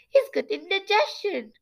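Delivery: high nasal exclamation; high-pitched nasal character voice; Shivereen. Acted. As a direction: exclaim it high through the nose. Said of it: "Shivereen exclaimed".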